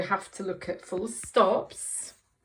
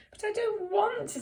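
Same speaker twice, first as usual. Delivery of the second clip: higher, reluctant whine; whiny frog; 13 semitones higher than usual. Acted. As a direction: whine that you don't want to. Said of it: "a bit of a whiny voice".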